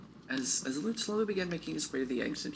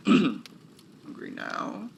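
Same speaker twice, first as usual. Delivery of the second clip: slow, loud drawn-out question; slow gruff voice; 7 semitones higher, 6 dB louder, much slower than usual. Shown as a demonstration. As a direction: stretch it out in a gruff voice. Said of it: "clears throat, retakes line".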